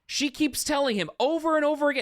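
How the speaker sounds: incredulous build; worked up. Real, not acted. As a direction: stress the repetition. Outraged retelling.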